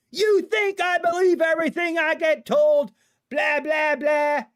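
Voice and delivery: high-pitched mock yell of an angry cop; imitating yelling. Trooper flipping out at the driver he stopped, mock yelling. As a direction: yell it mockingly, pitched well up. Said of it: "he was yelling at me".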